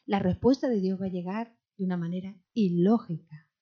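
usual speaking voice

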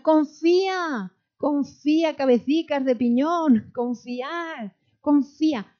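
high, forceful urging to trust; urgent exhortation. Real, not acted. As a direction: drive it home, calling out insistently. Preacher urges listeners to trust.

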